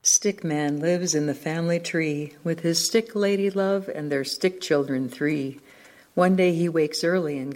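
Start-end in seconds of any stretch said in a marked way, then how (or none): none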